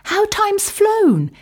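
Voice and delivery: high, loud greeting from the birds; flock greeting. Acted. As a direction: greet the returning travellers fondly. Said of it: "who said".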